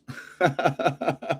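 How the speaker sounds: soft chuckle at own guess; laughing. Real, not acted. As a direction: laugh it off quietly.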